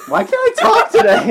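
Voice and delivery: high, loud laughing complaint. Real, not acted. Host flubbing the intro again, cracking up loudly. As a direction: crack up while asking it. Laughing at his own flub.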